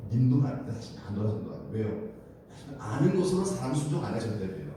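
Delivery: ordinary talk, nothing marked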